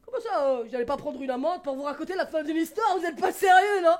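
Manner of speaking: loud, offended protest to the crowd; mock indignation. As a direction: act outraged at them.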